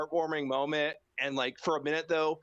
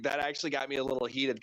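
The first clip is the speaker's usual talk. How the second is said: ordinary delivery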